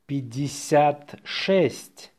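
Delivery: slow dictation, words set apart. Shown as a demonstration. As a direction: say it slowly for learners. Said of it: "say it slowly".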